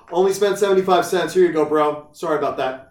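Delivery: hammed-up, punchy political-office voice; imagined honest staffer. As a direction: play an obliging staffer, loudly returning change. Imagined political staffer handing back change.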